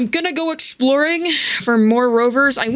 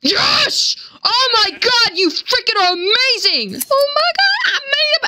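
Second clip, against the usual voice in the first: high squealing delight, real; shrieking with joy; 12 semitones higher than usual. Real, not acted. Player thrilled by the game, squealing with joy. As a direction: squeal at the win.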